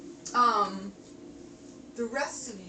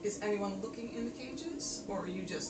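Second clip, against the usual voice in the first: game master narration; 5 dB quieter than usual. Acted. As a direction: draw the players into the dungeon. Game master sets the scene.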